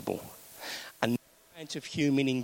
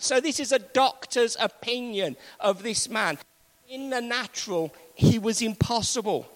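higher, rising, emphatic sermon voice; full-force preaching; 9 semitones higher than usual. Acted. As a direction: drive the point home like a fired-up preacher.

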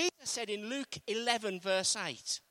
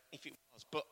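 usual speaking voice

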